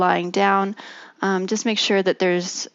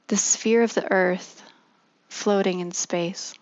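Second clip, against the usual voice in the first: guided meditation. Acted. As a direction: ease them gently into stillness.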